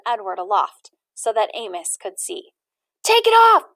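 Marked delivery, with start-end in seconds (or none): 3.0-3.7 put-on loud, high boyish shout; rowdy boy shouting the order. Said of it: "shouted Amos".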